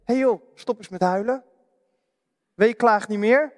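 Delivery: a hint of a careless friend's brush-off; lightly voiced stop-crying brush-off; blunt comforter. Preacher voicing a careless friend to a mourner, lightly. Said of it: "Maar het zou je maar gezegd worden".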